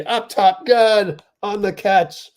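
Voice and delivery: high, loud call of the made shot; excited play-by-play call. Real, not acted. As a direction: punch it out as the shot drops. Calling a made three live.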